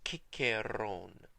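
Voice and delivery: Ciceron drawn out slowly as kee-keh-RON, hard k's; Greek-style Ciceron with k sounds; ancient pronunciation. Shown as a demonstration. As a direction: say Ciceron slowly, both c's as k. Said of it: "we know that his name was pronounced".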